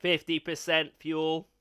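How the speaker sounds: slow, flat robot voice through a vocoder. Pretend. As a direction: speak like a glitching machine. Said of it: "I'm gonna turn on the robot".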